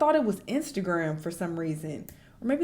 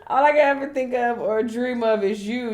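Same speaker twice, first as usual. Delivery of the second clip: higher, loud put-on swooning voice; mock lovesick fan; 3 semitones higher, 11 dB louder than usual. Reading his love text to Ruby in a hammy fan voice. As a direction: overact the desperate fan.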